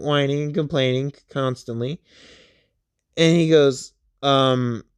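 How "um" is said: ordinary delivery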